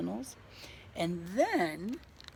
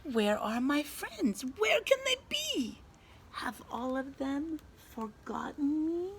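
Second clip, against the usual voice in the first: worried; 7 semitones higher than usual. A narrator's reading. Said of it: "Skunk searching, lonely".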